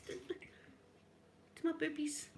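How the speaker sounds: softly scandalised aside; hushed. Real, not acted. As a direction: drop to a scandalised hush. Whispered indignation.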